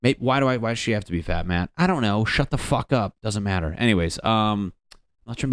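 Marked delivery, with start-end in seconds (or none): none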